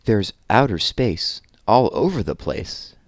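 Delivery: lively, sing-song read-aloud of a rhyming book; reading to children. Acted. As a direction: read it with bounce for the little ones. Reads rhyming rocket picture book.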